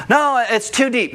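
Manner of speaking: put-on high, pleading kid; a scared child at the boat's edge; whiny child voice. Kid refusing to jump in.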